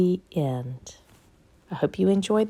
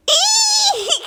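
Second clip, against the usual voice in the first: very high, loud laughing shriek; a high, loud squeal of fright; shrieked Eek; 6 dB louder than usual. A narrator's reading. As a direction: shriek it out like a terrified elephant. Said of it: "shouted the elephant".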